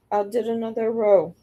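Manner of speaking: ordinary delivery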